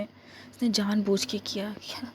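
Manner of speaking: slow suspicious whisper to herself; whispered suspicion. Acted. Stranded woman whispering her suspicion about the ladder. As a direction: whisper it as if afraid to be overheard.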